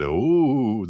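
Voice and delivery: high eerie ooh; mock-menacing ghost sound; spooky overlord. Inner overlord voice as a high spooky ooh. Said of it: "the overlord".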